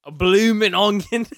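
loud, high, slow Australian drawl; mock Australian ad voice. Co-host imitating Outback commercial, broad mock Australian. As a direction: sell it like a cheesy Australian commercial. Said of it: "What's that one Outback commercial".

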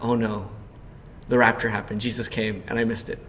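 low, subdued dawning dread; understated freak-out. Retold or imitated. Boy alone in the house realizing it, understated freak-out. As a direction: let the bad news sink in, softly. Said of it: "I start freaking out".

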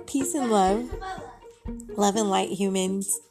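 ordinary talk

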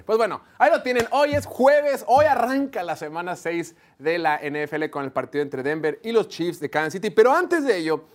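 booming show opener. Acted. Host restarts segment.

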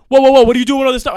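high, loud, fast whoa; driver's loud protest; alarmed driver. Retold or imitated. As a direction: mimic the panicked driver. Driver objecting.

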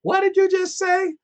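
high, indignant teacher demanding an answer; a scandalised teacher catching a kid; shocked teacher. Retold or imitated. Teacher confronts the boy over his dirty joke, high and indignant. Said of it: "and she's like".

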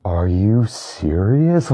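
slow, drawn-out disbelieving question; stunned disbelief. Retold or imitated. Fan on first seeing Winter Soldier, stunned disbelief. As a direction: let the reveal sink in. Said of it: "I was just like".

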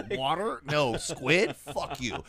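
high-pitched, incredulous comic refusal; mock refusal. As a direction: imitate someone balking at each suggestion. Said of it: "You're like".

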